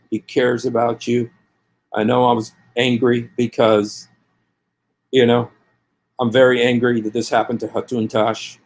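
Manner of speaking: ordinary delivery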